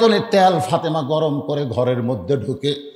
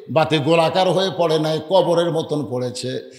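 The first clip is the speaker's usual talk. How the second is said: ordinary talk, nothing marked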